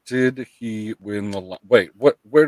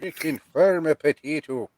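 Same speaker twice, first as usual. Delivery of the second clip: higher, weary Irish brogue; tired old Irish accent; 6 semitones higher than usual. Acted. Streamer echoing co-host's potato boast, tired Irish. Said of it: "you do your Irish accent like you're kinda tired".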